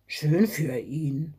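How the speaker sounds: low, slow sulky mutter; grumbled. A narrator's reading. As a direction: grumble it like a sore loser. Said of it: "brummte Herr Fuchs".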